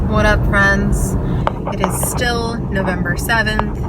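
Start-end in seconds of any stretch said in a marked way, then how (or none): none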